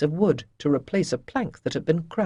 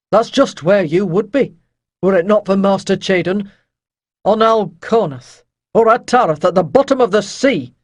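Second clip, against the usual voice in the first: louder, firm rebuke; stern captain; 3 semitones higher, 9 dB louder than usual. Acted. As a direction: put the sailor in his place. Rydell rebukes Avon.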